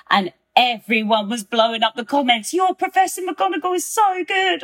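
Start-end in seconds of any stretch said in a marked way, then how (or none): 2.5-4.6 high-pitched mock gushing; gushing imitation. Retelling fans' comments on the videos, gushing. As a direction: imitate excited fans, pitched way up.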